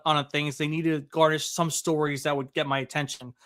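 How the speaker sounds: ordinary talk, nothing marked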